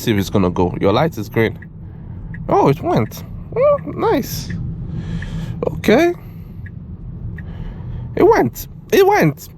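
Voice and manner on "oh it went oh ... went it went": high, amazed yelps as the car pulls off; surprised. Real, not acted. Driver amazed the self-driving car finally moved, high surprised yelps. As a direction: caught off guard, pitch jumping way up. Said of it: "I don't know why I'm surprised".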